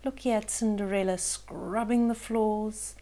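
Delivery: usual speaking voice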